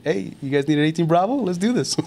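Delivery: high, pumped-up mock recruiter; gung-ho invitation, hammed up; eager pitch.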